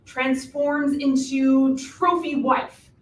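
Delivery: drawn-out, slow, thinking aloud; slow deliberate reveal. Acted. Witch reasons out the plot to the hero, slow and deliberate. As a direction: spell it out slowly like a grand discovery. Slowly spelling out requirement.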